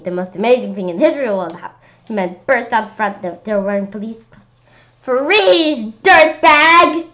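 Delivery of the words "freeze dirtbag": loud, higher cop shout; hollered. Acted. Police bursting in, hollering at the monster. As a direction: bark the order like TV cops. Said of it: "They hollered".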